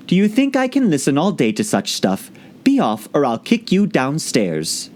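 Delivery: lively recited verse; poem recitation. A narrator's reading. Alice reciting Father William to the caterpillar. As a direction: recite with a bouncing rhythm.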